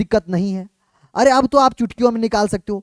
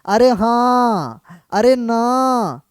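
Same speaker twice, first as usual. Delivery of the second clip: slow drawn-out cries; much slower than usual. Acted. Teacher drawls playful exclamations.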